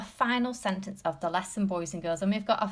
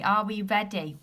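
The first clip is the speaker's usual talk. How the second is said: ordinary delivery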